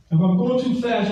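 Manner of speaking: ordinary talk, nothing marked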